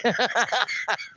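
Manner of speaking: trailing cackle; real laughter. Real, not acted. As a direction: burst out laughing, then fade. Cracking up after own quote.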